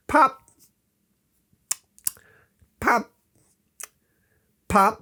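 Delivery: sharp high "pop" sounds like caps going off; popping sounds. Retold or imitated. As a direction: pop each one out high and quick. Imitating cap pops.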